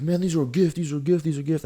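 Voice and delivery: high, put-on voice repeating it defensively; imitating the thief insisting the shoes were a gift, high and defensive; mock defensive thief. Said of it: "he got all defensive".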